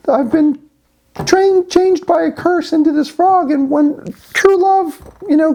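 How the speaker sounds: hammed-up fairy-tale princess in squeaky frog voice. Cursed frog promises boy true love, high falsetto. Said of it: "the frog says".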